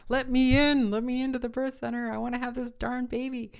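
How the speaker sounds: loud, insistent plea to be let in; imagined loud demand. Retold or imitated. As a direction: yell it like you're done waiting. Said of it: "I just wanted to say".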